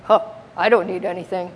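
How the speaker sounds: quick, dismissive scoff on "Huh, I don't need anything"; a lightly voiced proud snub, "Huh" scoffed; haughty scoff. Shown as a demonstration. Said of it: "There's no idea of".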